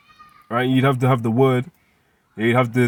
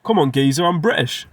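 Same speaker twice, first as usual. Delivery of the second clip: mock British accent; 2 semitones higher than usual. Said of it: "if you're born in".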